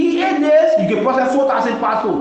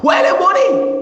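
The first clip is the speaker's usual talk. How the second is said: shouting demand; 9 semitones higher than usual. Real, not acted. Asking the Liberian people, an angry shout. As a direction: yell the demand at them.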